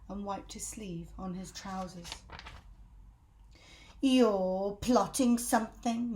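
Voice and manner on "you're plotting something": put-on slow, loud accusing old woman; Missus Twit voice. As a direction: drag it out, suspicious hag. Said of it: "Missus Twit said".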